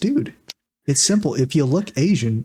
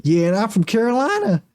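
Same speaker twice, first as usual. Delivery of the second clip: higher, smug mock voice; mock senator; 8 semitones higher than usual. Friend mocking the senator's logic, higher. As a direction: play the senator as a self-satisfied fool. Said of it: "He's like".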